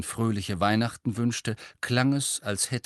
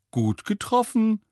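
high, loud teasing through a grin; grinning; 12 semitones higher, 6 dB louder than usual. A narrator's reading. Fred grinning over the portrait of Harry, high amused delivery. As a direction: tease him with a big audible grin. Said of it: "sagte Fred grinsend".

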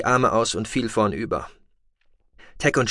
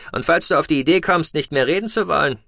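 a lightly raised, brisk voice for the pilot Tech; Tech's voice; 6 semitones higher, faster than usual. A narrator's reading. Tech telling the captive noble they are leaving, lightly voiced. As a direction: voice Tech a touch higher and brighter than the narrator.

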